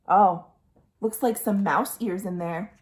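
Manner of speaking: plain reading voice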